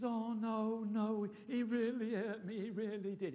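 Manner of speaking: a hint of a scared old man complaining; whiny old man. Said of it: "in that sort of voice".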